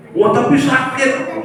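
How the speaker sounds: a hint of a sulking student; student's complaint.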